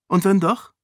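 schnell, höher und lauter eingeworfen; rasch. A narrator's reading. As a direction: sofort herausfordernd nachhaken. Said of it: "fragte Sarene rasch". (Sarene hakt bei Edan rasch und herausfordernd nach)

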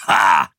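loud, low, rough "Ha!"; raspy bark of triumph; big man's exclamation. A narrator's reading. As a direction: burst out with one hoarse hah. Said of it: "sagte der gewaltige Mann".

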